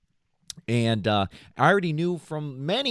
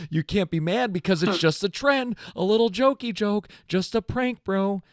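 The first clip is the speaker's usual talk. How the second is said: higher, louder mocking sing-song; 8 semitones higher, 4 dB louder than usual. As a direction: throw his excuse back mockingly. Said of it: "So I told him".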